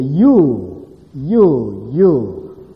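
"you" repeated loud, each one drawn and falling; three weighty, ringing hits on "you"; emphatic you. Shown as a demonstration. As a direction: hammer each "you" separately. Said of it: "with emphasis".

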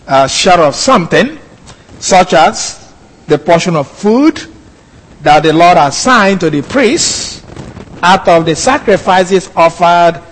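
ordinary delivery

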